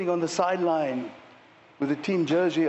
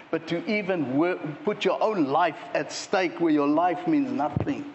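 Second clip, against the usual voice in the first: usual speaking voice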